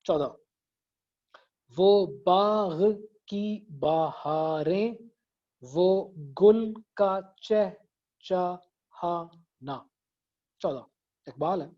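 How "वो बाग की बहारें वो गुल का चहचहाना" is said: very slow, drawn out syllable by syllable; deliberate syllable-counting demo. Shown as a demonstration. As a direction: count out each syllable, stretching it. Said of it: "एक आध शेर मैं और दिखा देता हूँ".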